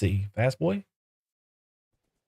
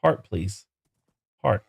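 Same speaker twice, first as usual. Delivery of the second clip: ordinary talk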